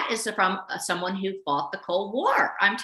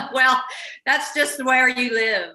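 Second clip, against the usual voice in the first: laughing; 5 semitones higher, 5 dB louder, slower than usual. Real, not acted. Laughing at the cloud joke.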